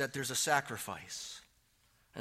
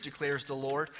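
ordinary talk

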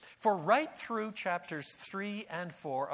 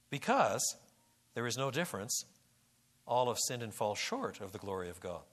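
ordinary talk

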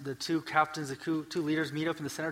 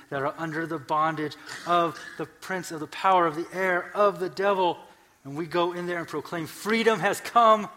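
building preaching; 5 semitones higher, 8 dB louder than usual. Real, not acted. Preacher proclaiming freedom to those in bondage, building to full force. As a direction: build from firm to loud, high proclamation.

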